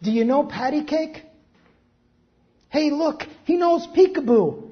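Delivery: old man shouting across the diner at the baby, mock loud; retold shout. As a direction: call across the room like a tipsy old man. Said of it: "began shouting across the room".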